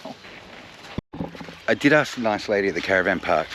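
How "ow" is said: high pained ows, feet burning; wincing as the hot water stings; walking on hot sand. Real, not acted.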